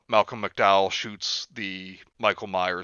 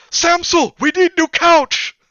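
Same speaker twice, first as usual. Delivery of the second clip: put-on high, loud accented shout; mother shouting to her husband about the couch; imitated yell; 10 dB louder, slower than usual. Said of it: "yells to her husband".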